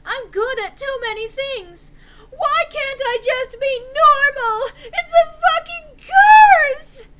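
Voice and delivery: building to a very loud high sobbing shout. Acted. Enderby screaming her woes at Draco, sobbing shout. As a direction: escalate from whine to screaming wail. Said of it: "I shouted".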